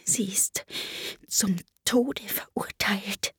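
slow, secretive whisper; hushed, halting confidence. A narrator's reading. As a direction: whisper it into someone's ear. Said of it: "und wisperte".

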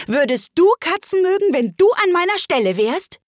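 high, loud, indignant shrieking; furious screech. A narrator's reading. Mouse challenges Alice about liking cats, shrieking furiously. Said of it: "mit kreischender, wütender Stimme".

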